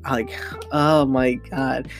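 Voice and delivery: ordinary talk, nothing marked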